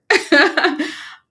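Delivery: short high laugh, real; high burst of laughter. Real, not acted. Laughs after quoting ChatGPT.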